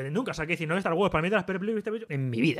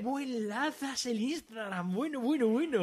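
mock teasing voice; 7 semitones higher, slower than usual. Co-host mocking the Instagram plug, high silly teasing voice.

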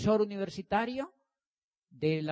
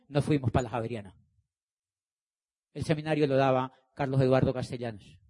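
ordinary talk

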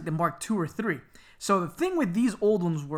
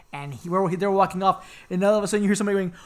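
ordinary talk

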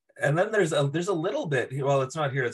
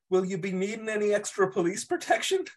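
mock O'Hara; 9 semitones higher than usual. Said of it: "O'Hara's like".

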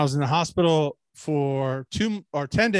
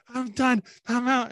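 higher, strained done-for voice; mock exhausted; 7 semitones higher, slower than usual. Stumbling out of the shower, mock exhausted. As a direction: gasp it out like you're finished. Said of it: "then I'd stumble out".

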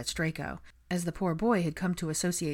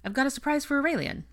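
plain reading voice

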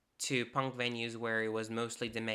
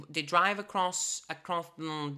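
normal talking voice

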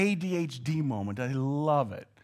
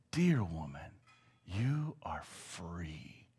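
tender Jesus; 9 semitones lower, 9 dB quieter, slower than usual. Acted. Jesus speaks to the healed woman.

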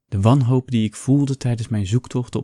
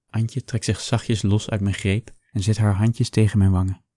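usual speaking voice

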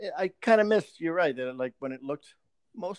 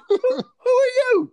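very high, slow, loud squeak; startled henchman; 8 dB louder, slower than usual. Pretend. Henchman squealing at a British Batman. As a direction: squeal it like a frightened thug.

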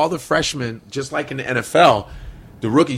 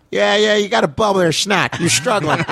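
lightly voiced coach, pitched higher; a hint of the old coach's catchphrases; impression of the coach; 6 semitones higher than usual. Doing his coach's catchphrases for teammates, mildly higher. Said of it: "and I imitated him".

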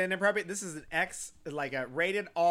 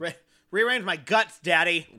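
mock plea; 2 semitones higher, 10 dB louder than usual. Joking outburst about surgery.

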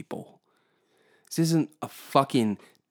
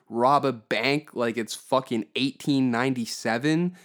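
ordinary delivery

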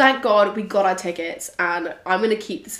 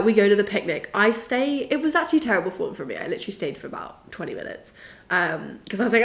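ordinary delivery

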